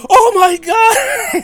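shrieking high and loud, drawn out; panicked high-pitched scream; terrified scream. Retold or imitated. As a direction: scream like you've walked in on a bloodbath. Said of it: "he screams".

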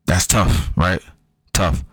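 ordinary talk

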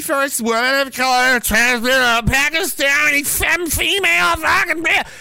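mocking rising rant. Real, not acted. Mocking the director.

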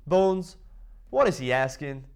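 imitated shout; shouting over. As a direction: call out to your caddy at a distance. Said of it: "then Phil shouts over".

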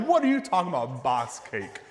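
mock-offended old lady; indignant grandmother. Grandmother angrily challenging her friend about the cake.

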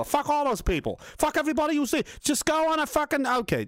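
high-pitched, escalating angry tirade; mock furious rant. Mock rant against Uber, then "he's crazy.

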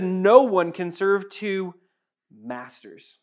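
each word laid down slowly, fading out; slow weighty quote. Acted. Pastor quoting Jesus to the church, slow and weighty. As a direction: let the verse land word by word.

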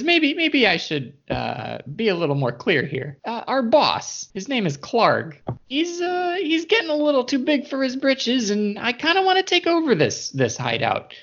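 a scheming goblin, pitched well up; sly goblin voice. Acted. Goblin plots against his boss.

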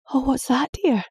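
anxious mum saying goodbye; worried mother. Acted. Mother voiced, higher.

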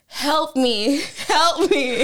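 wife hamming up a call for help; mock plea for help. Wife teasing strangers in the hall, mock plea. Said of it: "my wife looks at them and goes".

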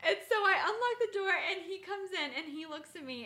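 ordinary talk, nothing marked

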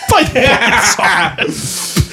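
slow, laughing chant; drawn out through laughter. Acted.

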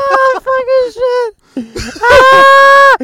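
high, loud, slow mock sob; mock anguished wail, loud and drawn out; hammed-up wail. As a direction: wail like you're breaking down. Hammed crying at film.

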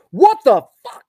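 loud, high exclaimed what the fuck; kid shouting. Retold or imitated. Son reacting to baby news, loud excited blurt. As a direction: blurt it out like an excited five-year-old. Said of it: "He goes".

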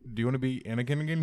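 fast, loud, excited pitch; loud, quick, excited question; eager offer. Retold or imitated. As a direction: rush it out like a thrilled producer. Acted offer to Hayden.

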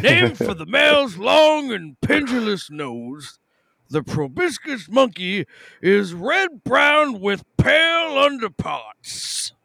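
slow, high Southern American drawl; Southern accent. Shown as a demonstration. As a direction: read it like a hammy Southern storyteller. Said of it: "read this in a Southern accent".

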